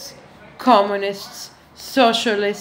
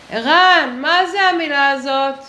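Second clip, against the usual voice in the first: higher, louder call to someone off; 5 semitones higher, 5 dB louder, much faster than usual. Acted. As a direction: holler the question over to a man. Calls a man to ask.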